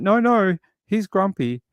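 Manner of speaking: high-pitched retold rebuttal; mock insistence. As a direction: argue back playfully, voice lifted. Retold argument about grumpy granddad.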